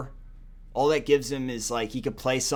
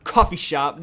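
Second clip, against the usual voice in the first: a hint of a higher, louder show-off voice; bragging persona; 10 semitones higher, 7 dB louder than usual. Hyping his [name] nickname to viewers, lightly hammed-up. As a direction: puff up like a cool nickname owner. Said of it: "I'm [name]".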